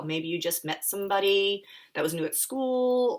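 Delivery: ordinary delivery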